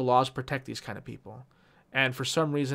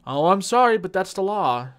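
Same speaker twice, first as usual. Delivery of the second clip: mock fake apology; 5 semitones higher, 6 dB louder than usual. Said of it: "when they say that".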